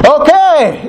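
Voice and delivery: high mock-cheery okay; flustered acceptance. Priest agreeing to wedding and funeral, flustered. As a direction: give in with a squeaky, strained okay.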